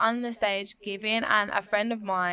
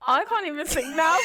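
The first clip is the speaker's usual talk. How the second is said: laughing; 5 semitones higher, 3 dB louder than usual. Real, not acted. Laughing fit during song attempt.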